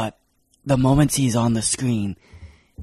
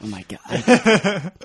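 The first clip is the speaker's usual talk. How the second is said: slow, stretched out through laughter; laughing; 6 semitones higher, much slower than usual. Real, not acted. Co-host cracking up at the pun run, laughing. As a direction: say it as the laugh takes over.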